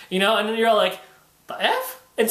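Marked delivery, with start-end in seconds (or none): 1.5-2.0 very high incredulous squeak; mock bewilderment. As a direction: squeak it out in comic disbelief. Acted friend's shocked reaction.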